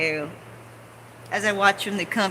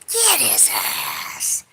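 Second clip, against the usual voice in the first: slow, breathy whisper; whispered egging; 5 semitones lower, much slower than usual. Retold or imitated. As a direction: hiss it under your breath. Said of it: "it's like".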